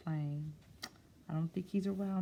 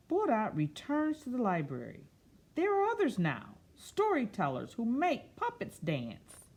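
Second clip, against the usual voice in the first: storybook reading; 3 semitones higher, 5 dB louder than usual. A narrator's reading. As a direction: read it like story time, savouring each word. Reads picture book aloud.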